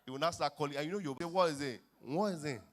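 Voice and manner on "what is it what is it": mock confrontation. Heated exchange about being stepped on.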